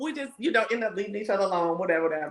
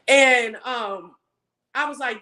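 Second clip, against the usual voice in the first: usual speaking voice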